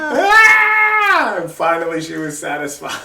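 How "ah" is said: very high, loud fake wail; boy faking a cry for his sister behind the door; fake cry scream. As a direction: let out a staged pained yell. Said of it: "you cry".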